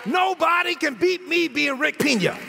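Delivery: emphatic, stretched-out preacher's boast; full-force preaching. Real, not acted. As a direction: proclaim it proudly to the church.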